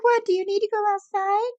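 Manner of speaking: high sing-song imitation of a dog owner; mocking Jen's cooing at her dog, high baby talk. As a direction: ham up a cooing pet owner, pitched high. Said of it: "and you're like".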